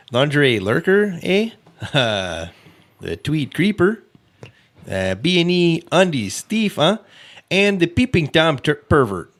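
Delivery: laughing Canadian accent with eh; mock Canadian, laughing through it. Shown as a demonstration. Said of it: "once more in a Canadian accent".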